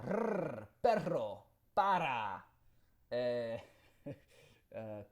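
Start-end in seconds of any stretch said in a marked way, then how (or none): 0.1-2.4 Spanish "perro, para", tongue-tip trill on each R; rolled R. Shown as a demonstration. Proving he's Cuban, showing off the trilled R in "perro, para". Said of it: "the rolling R".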